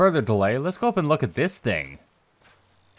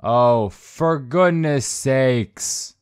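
very slow, stretched-out complaint; long, weary-of-this exclamation; drawn-out exasperation; 4 dB louder, much slower than usual. Real, not acted. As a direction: drag out every word in exasperation. Exasperated at what game shows.

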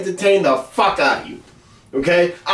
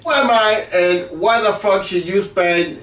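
ordinary talk, nothing marked

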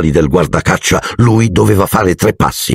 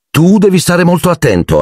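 higher, harsh warning; sharp tone; 12 semitones higher than usual. A narrator's reading. Mrs Weasley warning her husband Arthur, sharp and higher. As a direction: scold him sharply. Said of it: "in tono aspro".

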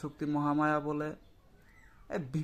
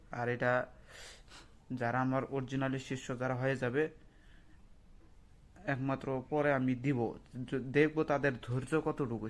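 normal talking voice

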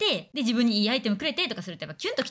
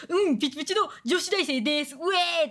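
mock bubbly college girl; 4 semitones higher than usual. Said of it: "とか言って".

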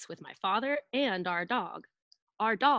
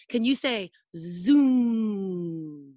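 coaxing prompt; 5 dB louder, much slower than usual. Shown as a demonstration. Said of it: "teacher prompts children".

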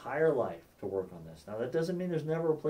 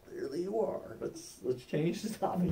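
backing off; 3 semitones higher, 5 dB quieter than usual. Acted. Backs away from heated conversation.

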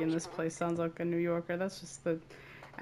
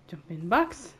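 playful call; 6 dB louder than usual. Pretend. Playful game command to self.

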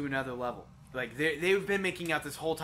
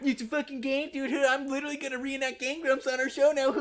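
high, exasperated mock protest; high mocking imitation; mocking quote; 8 semitones higher than usual. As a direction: throw their words back in a high voice. Mocking quote of friend's outburst.

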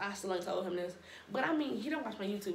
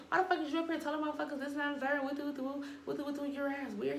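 higher, fast mock rant as the boyfriend; mimicking angry boyfriend; 6 semitones higher than usual. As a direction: fire it off quick, imitating his anger. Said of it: "On TikTok, he said".

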